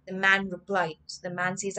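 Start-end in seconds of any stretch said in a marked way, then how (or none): none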